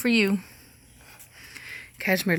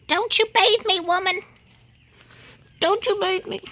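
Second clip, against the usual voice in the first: much higher, squeakier mock protest; voicing indignant animals; 12 semitones higher, 4 dB louder than usual. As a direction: squeal it as a pet outraged at bath time. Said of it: "They're like".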